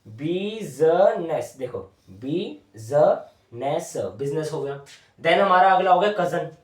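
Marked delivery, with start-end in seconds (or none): 0.1-3.9 1 slow drawn-out word. Shown as a demonstration. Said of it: "teacher drawing and saying word".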